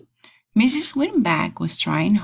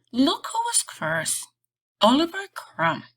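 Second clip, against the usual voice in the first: much higher, bright announcing; excited reveal; 11 semitones higher than usual. A narrator's reading. Narrator eagerly introduces Oliver at graduation, excited. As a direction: announce the first graduate with a lift.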